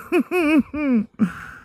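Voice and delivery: hooting high laugh. Real, not acted. Laughing after his accent story, high hooting laugh.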